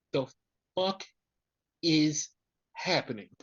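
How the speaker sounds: THE... FUCK... IS... HAPPENING, each word set apart by a pause; baffled, word by word. Real, not acted. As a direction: break up "The fuck is happening" one word at a time. Baffled by the random return.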